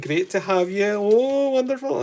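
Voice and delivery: high, loud mock Aberdeenshire Scottish accent; imitating Aberdeenshire locals. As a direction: parrot the friendly Peterhead folk in a Scottish accent. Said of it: "they're all quines and loons".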